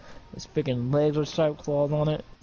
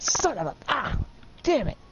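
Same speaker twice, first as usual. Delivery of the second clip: cursing, rising much higher into pain; pained curse; 10 semitones higher than usual. Acted. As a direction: swear as the blade hits. Alan cut, cursing in pain.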